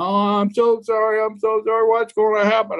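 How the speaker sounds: high whiny mock blubbering; imitating bawling. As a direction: grown man mock-sobbing an apology. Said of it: "he's bawling like a baby now".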